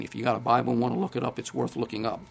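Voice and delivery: ordinary talk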